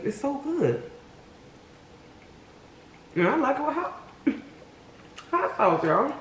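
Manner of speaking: talking with mouth full of food. Real, not acted. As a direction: speak around a mouthful of food. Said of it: "I be talking with my mouth full".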